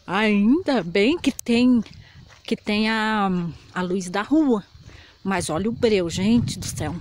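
usual speaking voice

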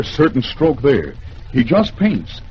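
ordinary delivery

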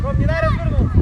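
high, loud urgent call; yelling from the sidelines. Real, not acted. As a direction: shout the instruction across the field. Coach calling instruction to player. (крик с бровки)

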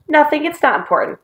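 high-pitched brush-off; defensive, high dismissal; hasty denial. Acted. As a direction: wave the question away.

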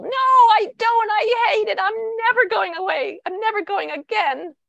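high, loud tantrum, easing slightly at the end; entrenched child refusal. Acted. Child arguing back at parent about school, high tantrum refusal. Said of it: "the more the other person gets entrenched".

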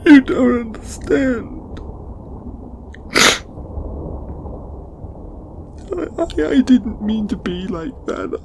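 high-pitched, upset pleading; distraught apology. Acted. Apologising to a friend for getting snippy, distraught pleading. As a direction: beg forgiveness in a high, breaking-up plea. Tearful plea for forgiveness.

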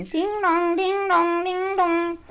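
high, loud repeated chant; crazed yelling. Acted. Mother going crazy in the car, yelling high and loud. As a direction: ring it out like a manic doorbell. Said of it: "starts yelling and".

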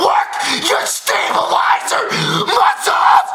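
crank up into a yell at the end; building yell. Real, not acted. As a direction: rant peaking in a shout.

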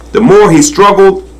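ordinary delivery

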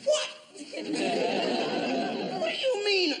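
high, drawn-out indignant squeal; outraged disbelief. Retold or imitated. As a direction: stretch it out in shocked disbelief. Lulu outraged at apology.

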